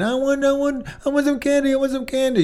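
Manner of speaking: put-on high, nagging child begging for candy; whining child. Child whining at parent for candy, high and nagging. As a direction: whine like a kid in the store. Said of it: "When they whine".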